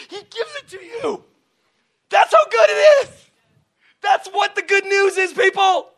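high, loud shouted sermon; preacher shouting the good news; full-force preaching. Acted. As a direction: proclaim it at the top of your voice.

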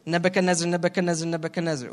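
Nebuchadnezzar rattled off in a run; rapid-fire, no breath between names; real fast. Shown as a demonstration. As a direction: race through it like a tongue-twister. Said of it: "say it three times real fast".

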